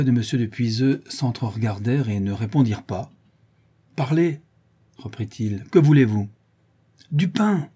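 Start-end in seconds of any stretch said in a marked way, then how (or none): 3.9-4.4 higher-pitched order to speak; sharp command. A narrator's reading. Il somme la foule de répondre. 5.7-6.3 louder, higher, falling at the end; insistent demand. A narrator's reading. Il répète sa question avec insistance. 7.1-7.7 high-pitched call for bread; bold crowd cry. A narrator's reading. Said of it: "crièrent cette fois les plus hardis".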